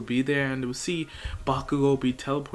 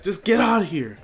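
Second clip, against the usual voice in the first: louder, higher command; urgent order; 6 semitones higher, 5 dB louder than usual. Retold or imitated. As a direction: send them away, forcefully. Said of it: "Izuku's like".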